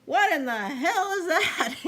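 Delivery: loud, high, belligerent yell with a laugh; hammed-up furious shout; belligerent scream. Shown as a demonstration. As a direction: scream it out, picking a fight. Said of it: "She screamed belligerently".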